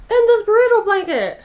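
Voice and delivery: high, loud gleeful burst; excited squeal. Real, not acted. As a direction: squeal it out with joy. Excited about gift.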